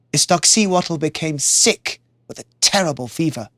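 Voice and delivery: ordinary delivery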